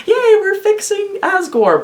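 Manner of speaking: very high, sing-song yay; mock cheer. Fan mimicking the party's glee about fixing Asgore, very high.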